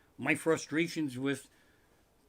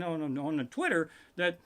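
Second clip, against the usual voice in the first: usual speaking voice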